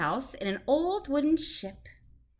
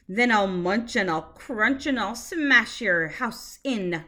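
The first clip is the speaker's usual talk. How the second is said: shark roar; 5 dB louder than usual. Acted. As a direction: boom it out to scare the fish. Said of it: "roared the shark".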